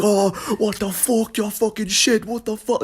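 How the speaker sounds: hammed-up angry British coach; British coach yelling. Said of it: "the coach was some British dude, he was screaming".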